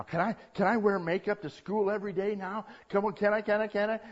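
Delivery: put-on high, insistent teen pleading; begging teenager. Thirteen-year-old begs Mom to wear makeup, pleading. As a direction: pile up the begging, pitched high. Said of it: "I'm a teenager now".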